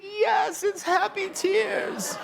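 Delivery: put-on very high, slow, drawn-out little-girl echo; child's voice. Daughter echoing her mother after the proposal, very high and slow. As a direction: echo it back like a tiny child, stretched and squeaky. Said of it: "And she's like".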